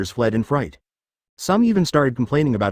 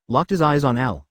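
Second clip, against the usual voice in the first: ordinary delivery, nothing marked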